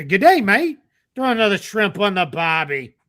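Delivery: high, hammed-up Australian greeting; Australian accent. Airline passenger arriving in South Africa, mock Australian accent. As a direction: put on a jokey Australian accent. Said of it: "that's an Australian accent".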